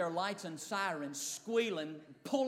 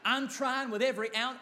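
ordinary talk, nothing marked

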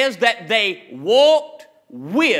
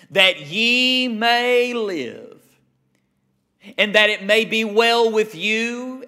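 slow, stretched-out scripture reading; slower than usual. Acted. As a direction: draw out each phrase of the verse solemnly. Reads scripture aloud.

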